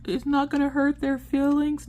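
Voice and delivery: put-on high, worried kid voice; sensitive child. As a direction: ask like an anxious little kid. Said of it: "Orion's a very sensitive kid".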